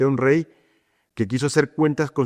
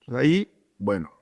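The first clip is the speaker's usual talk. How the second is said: normal talking voice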